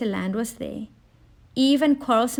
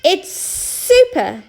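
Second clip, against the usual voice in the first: slow, higher snake hiss; 9 semitones higher, 5 dB louder, slower than usual. A narrator's reading. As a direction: drag out the s like a snake. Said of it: "hissed Sammy Snake".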